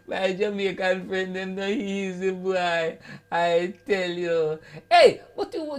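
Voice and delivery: ordinary delivery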